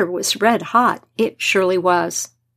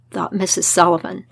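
usual speaking voice

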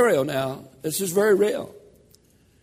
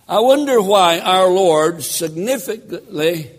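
ordinary talk

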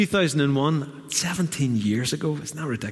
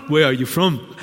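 imitated African accent, a little louder; 3 semitones higher, 5 dB louder than usual. Man questions the newcomer in church, African accent. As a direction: put on a Ghanaian man's African accent. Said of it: "I'll start with the African accent".

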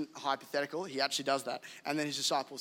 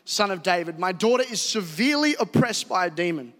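crying out; 4 semitones higher, 8 dB louder than usual. A narrator's reading. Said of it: "was crying".

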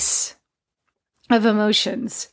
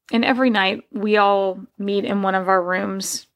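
usual speaking voice

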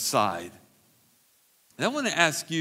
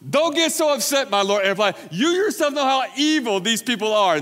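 Aaron pleading, pitched up; placating whine, raised; Aaron's excuse, high pitched; 8 semitones higher, 5 dB louder than usual. A narrator's reading. Aaron voiced again.

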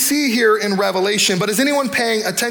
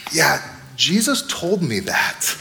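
calm believer; 5 semitones lower, slower than usual. Retold or imitated. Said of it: "You get to engage and say".

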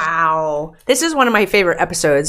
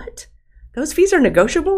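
surprised; 10 semitones higher, 3 dB louder than usual. Retold or imitated. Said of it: "I was like".

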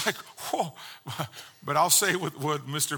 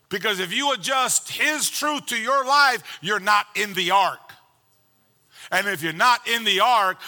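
raised, ringing pulpit voice driving each point home; full-force preaching; 5 semitones higher, 5 dB louder than usual. Acted. Pastor preaching to the congregation about the ark, full-force preaching. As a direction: preach it hard, louder and higher, like the room must hear.

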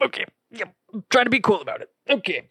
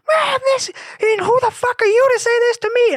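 much higher and louder, shrieking outrage; screaming mother; 6 dB louder than usual. Retold or imitated. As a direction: shriek it like an offended shopper. Mother's shrill outburst retold.

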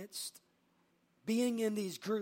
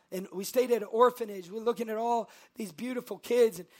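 normal talking voice

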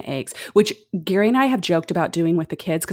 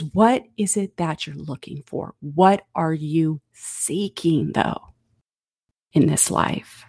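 tender question; 5 semitones lower, slower than usual. Acted. Tender restatement of Jesus's question.

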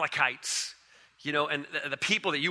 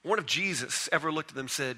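ordinary talk

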